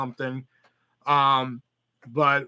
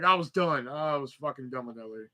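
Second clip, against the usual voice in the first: normal talking voice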